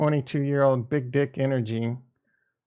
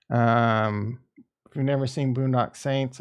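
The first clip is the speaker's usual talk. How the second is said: usual speaking voice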